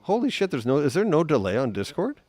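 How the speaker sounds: sudden high, loud exclamation; surprised. Real, not acted. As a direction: blurt it out in real surprise. Surprised there is no delay.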